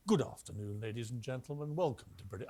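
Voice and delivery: a touch of a refined British captain on the tannoy; posh airline captain. Retold or imitated. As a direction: imitate a smooth posh pilot welcoming passengers. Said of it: "a lovely posh voice saying".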